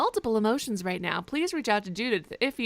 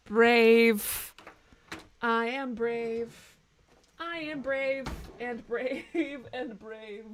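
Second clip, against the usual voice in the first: mock dramatic; much slower than usual. Slow dramatic declaration.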